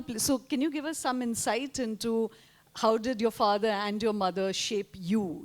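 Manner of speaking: ordinary talk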